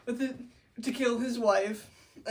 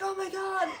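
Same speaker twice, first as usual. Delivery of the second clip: mock horror; 8 semitones higher than usual. Bianca finds her boyfriend wounded, mock horror. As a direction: play a horrified girlfriend, hammed up.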